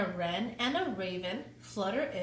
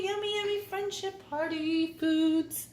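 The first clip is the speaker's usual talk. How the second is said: higher sing-song treat voice; delighted cooing; 6 semitones higher than usual. Acted. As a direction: coo over the treats. Cooing about food.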